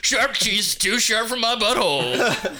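slow, high comic proclamation; silly high voice. Acted. Topping the sharp cheese joke, slow high silly voice.

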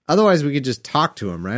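ordinary talk, nothing marked

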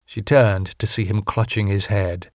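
plain reading voice

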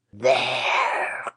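put-on low, dull old-crone croak. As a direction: croak it out like a hag. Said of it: "croaked the old woman in a dull voice".

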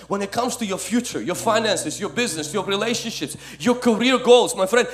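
loud, high preaching over the music; full-force preaching. Acted. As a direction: rouse the congregation.

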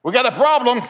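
loud, high call for help; retold yell. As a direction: yell out to the nurse in alarm, retelling it. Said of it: "And I yelled".

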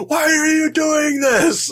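high-pitched imitated outcry from the dock; mock scream. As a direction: cry it out like a man out of control. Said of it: "just screaming, you know".

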